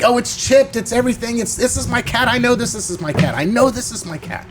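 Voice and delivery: high, insistent retelling of the owner; excited cat owner. Retold or imitated. Cat owner insisting to the storyteller, high and excited. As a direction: mimic the owner pitching up as he insists. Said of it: "And he's like".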